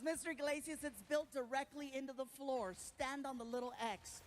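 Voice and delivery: sweet, careful nurse voice; nurse instructing. Retold or imitated. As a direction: imitate a nurse patiently giving directions. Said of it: "She goes".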